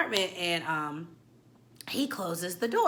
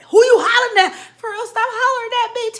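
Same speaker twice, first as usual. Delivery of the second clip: loud, higher, laughing talk-back; indignant yell; 13 dB louder than usual. Retold or imitated. Hurt at being yelled at, loud indignant comeback. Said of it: "I don't like nobody to yell at me".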